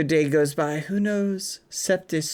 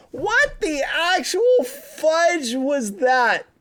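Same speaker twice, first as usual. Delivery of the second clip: disbelief; 9 semitones higher, slower than usual. Real, not acted. Reacts to absurd story ending.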